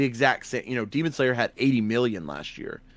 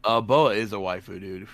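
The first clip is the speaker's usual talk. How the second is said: ordinary delivery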